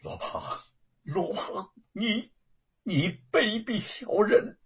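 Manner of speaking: halting, breath cut short, then slow high accusation; trembling with rage. A narrator's reading. Wu facing his enemy, trembling with rage. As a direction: choke on the words in fury. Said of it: "气得浑身哆嗦".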